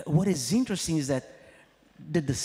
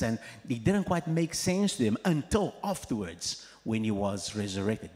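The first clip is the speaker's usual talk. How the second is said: ordinary talk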